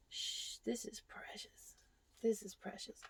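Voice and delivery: treat it as something precious; whisper. Real, not acted. Hushed over mother-in-law's gift.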